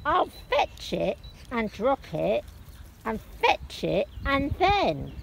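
soft, slow eager dog voice; a dog gloating over its stick, slow; quiet, slow dog's delight; playful dog. Acted.

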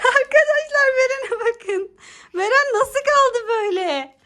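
high, slow laughing exclamation. Real, not acted. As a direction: laugh through the words in delight. Laughing at frozen child.

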